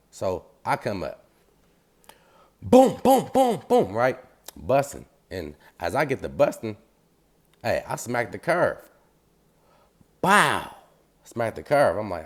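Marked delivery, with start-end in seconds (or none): 2.6-3.9 loud, high-pitched booms; imitated gunshots. Storyteller mimics himself busting back, loud gunfire booms. 10.2-10.7 loud, high impact noise; imitated crash. Storyteller mimics smacking the curve, loud high crash noise.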